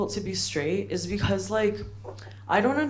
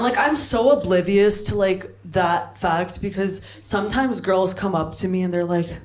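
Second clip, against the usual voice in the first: ordinary talk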